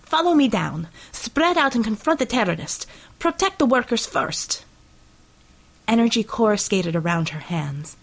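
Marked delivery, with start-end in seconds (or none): 0.0-4.6 higher-pitched, commanding delivery; raised voice. A narrator's reading. Red Savior to her troops before the attack, raised voice. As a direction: give orders to a whole squad. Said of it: "She raised her voice".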